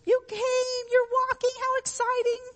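put-on very high, gushing praise for a toddler; excited parent. Parent cheering a toddler's first steps, very high gushing delight. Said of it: "The parent claps their hands".

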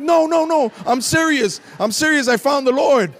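loud and high, pleading to be believed; insistent. Retold or imitated. Son insisting to his father, loud and high. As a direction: make him believe you.

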